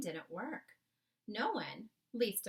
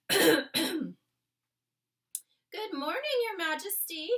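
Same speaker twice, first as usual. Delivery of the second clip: put-on higher, louder, respectful old maid; a maid greeting the Queen politely; oldish maid; 9 semitones higher, 12 dB louder, slower than usual. The maid greets the Queen in the morning, oldish respectful voice. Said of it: "the voice of an oldish person".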